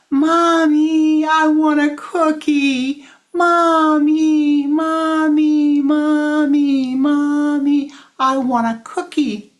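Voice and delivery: put-on high, loud screaming child. Child screaming at busy mommy for a cookie. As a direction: scream for the cookie at the top of your lungs.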